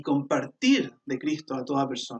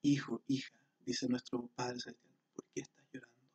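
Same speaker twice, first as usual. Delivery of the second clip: tender father voice; 3 semitones lower, 9 dB quieter than usual. Pretend.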